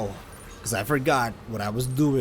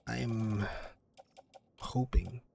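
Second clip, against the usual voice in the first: ordinary talk, nothing marked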